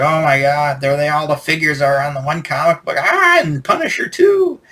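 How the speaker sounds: geeking out at a discovery; excited kid. Retold or imitated. Reliving childhood excited reaction.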